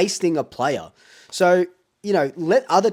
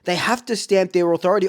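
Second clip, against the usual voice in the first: ordinary talk, nothing marked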